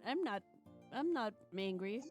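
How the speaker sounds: ordinary delivery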